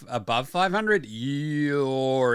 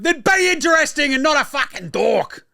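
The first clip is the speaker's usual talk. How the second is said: higher, louder mocking retort; mock shout; 13 semitones higher, 6 dB louder than usual. Fan yelling a retort at Tatum, higher and louder. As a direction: shout it back at him like a fed-up fan. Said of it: "It's like".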